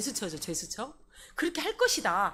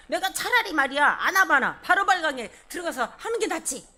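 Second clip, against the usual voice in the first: loud, higher-pitched mock outrage; indignant Naaman; 4 semitones higher, 7 dB louder than usual. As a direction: act out Naaman fuming and protesting. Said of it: "막 분노하면서 떨었어".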